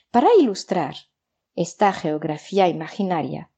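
normal talking voice

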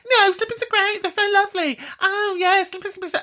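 very high, silly mimicked objectors; high-pitched mock protest; mocking imitation. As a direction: squeal it in mockery of the complainers. Mimicking people who defend slippers.

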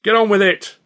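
high, loud yell from the audience; far-off punter yelling at the stage; heckler's shout. A narrator's reading. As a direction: heckle impatiently from the stalls. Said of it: "There was a shout from the stalls".

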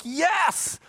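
high, exultant mock "yes"; imitated gleeful cheer. Preacher voicing Israel delighted by rivals' sin, mock gleeful cheer. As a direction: let the cheer leap way up in pitch.

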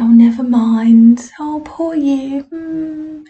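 high, drawn-out pitying coo; mock sympathy. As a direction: imitate people pitying someone, slow and high. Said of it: "that kind of sympathetic smile thing".

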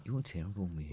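soft, low, sly dig; quietly imitated sly film line; sly quote. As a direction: say it slyly through a smile. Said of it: "that sly".

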